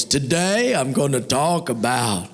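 large, slow booming voice; slow Shakespearean proclamation; booming orator. Acted. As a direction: announce it grandly, drawing it out. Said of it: "in a large booming voice".

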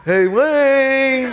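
high, drawn-out pestering call; Mike. Retold or imitated. Roommate calling through the door at night, pestering. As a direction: stretch the name out, high and loud. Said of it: "And it's Mike".

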